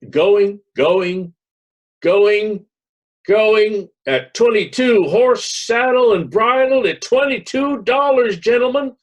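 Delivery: loud, high, drawn-out auction cry; auctioneer's call. Acted. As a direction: bellow the bids across the plaza. Auctioneer calling out sale.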